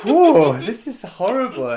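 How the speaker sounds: loud, high mock outraged teacher; imitating Philippe. Actor recalling Philippe's reaction on stage, loud. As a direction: blast it like a scandalised teacher. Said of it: "It was like".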